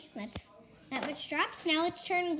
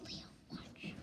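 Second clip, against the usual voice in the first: whispering; 18 dB quieter than usual. Real, not acted. Aside to brother.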